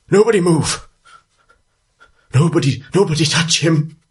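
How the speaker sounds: put-on high, loud, panicked old voice; distressed old man. Old alchemist begging the crowd to keep off his creature, high panicked cry. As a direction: cry out to keep everyone back, strained and high. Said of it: "this distressed old man looks up at you and says".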